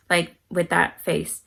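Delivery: normal talking voice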